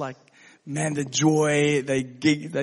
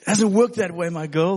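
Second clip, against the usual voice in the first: playful firm; 3 semitones higher, 7 dB louder than usual. Retold or imitated. Dad teasing daughter.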